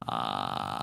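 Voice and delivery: long creaky "ah" at the bottom of the range; soft, very low fry note. Shown as a demonstration. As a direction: sink into a low rattling fry. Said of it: "just going lower".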